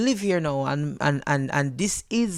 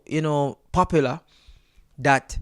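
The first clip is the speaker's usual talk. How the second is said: usual speaking voice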